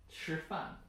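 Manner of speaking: "吃饭" with 吃 held high and level; first-tone demo. Shown as a demonstration. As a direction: carefully model "吃饭", first tone on 吃. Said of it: "So the first one".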